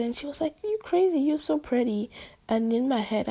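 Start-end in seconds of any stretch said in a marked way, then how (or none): 0.6-2.1 friend gushing, voice pitched high; friend's high voice. Retold or imitated. Friend's reply in retold chat.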